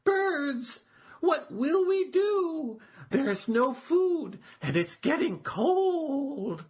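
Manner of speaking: a turtle calling to his friends, pitched high. A narrator's reading. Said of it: "shouted to his friends".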